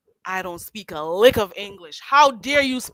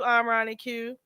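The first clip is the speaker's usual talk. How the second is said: usual speaking voice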